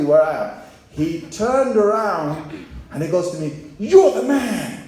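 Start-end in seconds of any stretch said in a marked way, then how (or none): 3.8-4.8 loud, high, drawn-out acclaim; imitated hearty exclamation. Grateful customer turns to his benefactor, hearty mock exclamation. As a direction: call it out slow and big, imitating a delighted stranger.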